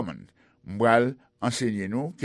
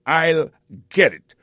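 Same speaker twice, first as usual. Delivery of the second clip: louder slow I'll get it; angry; 5 semitones higher, 7 dB louder, slower than usual. Acted. Pete snapping at the asker, mad. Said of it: "answered very mad".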